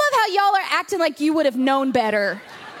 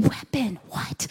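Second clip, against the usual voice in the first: low, hushed disbelief; stunned thought; 7 semitones lower, 6 dB quieter, slower than usual. Retold or imitated. Traveler asked about a weapon thinks to herself, stunned hush. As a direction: drop to a baffled near-whisper, as if thinking.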